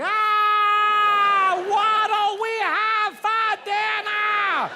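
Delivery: put-on very high, loud toddler shriek in Boston accent; Boston toddler scream. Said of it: "all toddlers sort of have a Boston accent".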